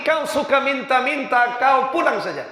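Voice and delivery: high, scornful scolding; judge scolding. Acted. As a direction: drive the beggar away with contempt. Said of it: "justru dimaki-maki". (hakim memaki dengan nada tinggi)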